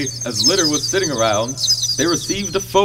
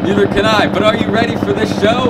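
raised voice over rotor noise; shouting over helicopter; 5 semitones higher, 5 dB louder than usual. Acted. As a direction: yell to be heard over the engine.

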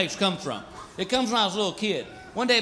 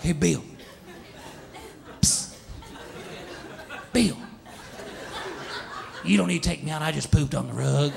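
sneaky dog whisper; 4 semitones lower, 6 dB quieter than usual. Retold or imitated. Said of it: "wake you up ... like".

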